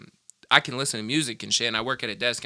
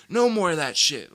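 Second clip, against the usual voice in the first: mock angry Grandpa; 6 semitones higher, 4 dB louder than usual. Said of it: "he was just so mad".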